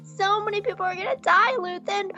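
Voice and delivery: high, mock-horrified voice; upset Mon Mothma. Mon Mothma scolding Luthen, high mock horror. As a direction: plead in hammed-up alarm. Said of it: "She's like".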